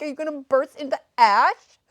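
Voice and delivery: loud, baffled disbelief; incredulous. Retold or imitated. Quoting own confused notes.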